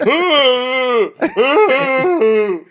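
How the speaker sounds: very high, loud, long "ooh"; mock whooping. Guys speeding down the driveway, high mock whooping. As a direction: hoot like rowdy guys arriving. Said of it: "car coming, speeding down the driveway, going".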